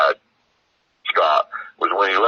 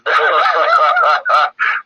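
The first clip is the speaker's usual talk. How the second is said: laugh; 9 semitones higher, 4 dB louder than usual. Real, not acted. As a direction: burst of real laughter. Laughs at host's question.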